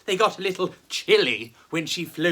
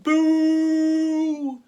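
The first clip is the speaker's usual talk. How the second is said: drawn-out boo; 9 semitones higher, 4 dB louder than usual. Acted. Narrator imitating audience booing.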